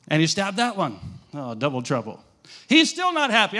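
ordinary talk

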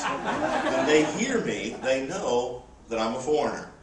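ordinary delivery

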